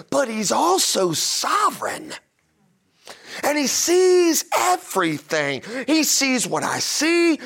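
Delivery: high-pitched, emphatic preacher delivery; impassioned preaching. Real, not acted. Pastor telling church about a sovereign God, impassioned high preaching. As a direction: preach it fervently, leaning hard on key words.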